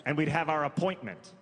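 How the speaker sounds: ordinary delivery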